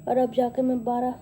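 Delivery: usual speaking voice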